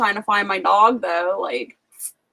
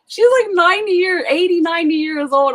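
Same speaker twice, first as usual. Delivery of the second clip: incredulous; 7 semitones higher than usual. Real, not acted. Shocked retelling of old woman.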